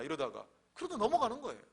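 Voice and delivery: ordinary talk, nothing marked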